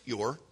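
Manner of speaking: YOUR set alone, low and soft; quiet, deliberate YOUR, standing by itself; stress on YOUR. Shown as a demonstration. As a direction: give the word your its own weight. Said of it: "the important word is".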